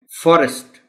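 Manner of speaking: Forest. loud and high, primary stress on the first syllable. Shown as a demonstration. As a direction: hit the fo in forest.. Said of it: "stress कहाँ है".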